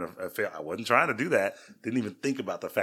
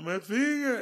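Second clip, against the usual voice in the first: put-on child's cry; 3 dB louder, slower than usual. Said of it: "he's like".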